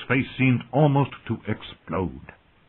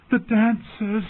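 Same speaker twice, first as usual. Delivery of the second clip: high, horrified cry; screamed; 11 semitones higher, slower than usual. A narrator's reading. Dany carried toward the dancing shadows, screamed. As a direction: cry out in terror at a vision. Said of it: "she screamed".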